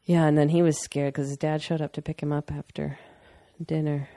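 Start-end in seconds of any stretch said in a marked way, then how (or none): none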